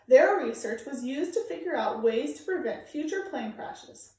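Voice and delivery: storybook narration, clear and expressive; reading to children. Acted. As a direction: read the picture book aloud to the kids. Reads picture book aloud to kids.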